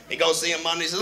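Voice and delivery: ordinary talk, nothing marked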